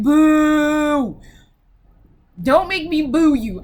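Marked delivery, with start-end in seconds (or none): 0.0-1.2 long loud booing; drawn-out loud boo. Retold or imitated. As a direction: boo them out, louder. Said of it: "I was like".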